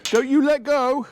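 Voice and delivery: put-on high, drawn-out plea from an elderly father; a nervous old dad in a wheelchair on a steep hill, high pleading; imitating Dad. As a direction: mimic Dad's anxious squeak, slowly. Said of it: "He said".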